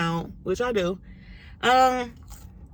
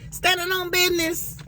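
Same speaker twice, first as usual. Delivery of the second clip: sassy high catchphrase; 11 semitones higher than usual. Acted. Proud catchphrase.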